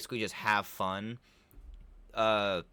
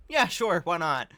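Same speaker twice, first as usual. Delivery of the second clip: put-on breezy eager girl; 5 semitones higher, 5 dB louder than usual. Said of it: "Jenna's just like".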